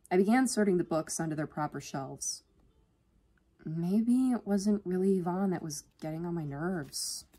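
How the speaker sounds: ordinary delivery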